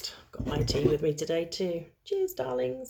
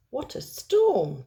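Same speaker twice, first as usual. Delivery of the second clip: higher, louder and slow little bird voice; twittering wren; 7 semitones higher, 5 dB louder, slower than usual. Acted. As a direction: chirp it like a small wren. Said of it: "twitters wren".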